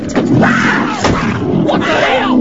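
high, drawn-out shriek; startled scream. Acted. Man finds a cougar in the car, screaming. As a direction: scream it in shock.

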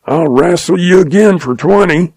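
shouted challenge. Acted. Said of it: "shook his fist at the lion and shouted".

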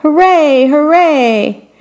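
high, drawn-out storybook hooray; mock crowd cheer, pitch lifted. A narrator's reading. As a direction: cheer like a delighted audience. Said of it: "the audience shouted".

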